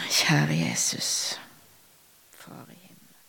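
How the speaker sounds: hushed and reverent, barely voiced; whispered prayer. Real, not acted. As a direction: breathe it as a private prayer. Turns from preaching to prayer.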